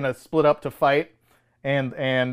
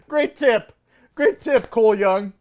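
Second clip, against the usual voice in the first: sarcastic cheer, pitched up and amused; mock gratitude; 6 semitones higher than usual. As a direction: mockingly congratulate him on useless advice. Mockingly thanks a film character.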